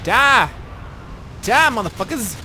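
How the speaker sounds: high screaming rage at enemies; furious battle yell. Real, not acted. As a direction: scream it like a berserker. Raging at enemies in game.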